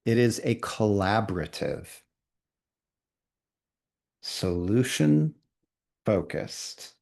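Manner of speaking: very slow, long pauses between each phrase; really slowly. Shown as a demonstration. Teacher dictating very slowly so trainees can draw. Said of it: "I'm gonna do it really slowly".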